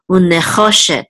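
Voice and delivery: "unechoshet" with the accent on "cho"; stress on CHO. Shown as a demonstration. Teacher sounding out the whole word, "cho" of "unechoshet" stressed. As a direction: hit the "cho" in "unechoshet", then fall away. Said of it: "the accent is on cho".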